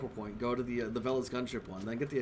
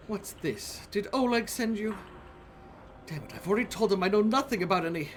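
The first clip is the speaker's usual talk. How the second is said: irritated librarian; 6 semitones higher than usual. Acted. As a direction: bristle at the name Oleg. Librarian reacts to Oleg's name.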